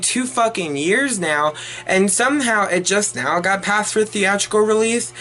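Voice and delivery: ordinary talk, nothing marked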